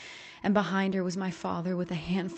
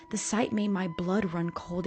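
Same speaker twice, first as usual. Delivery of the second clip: usual speaking voice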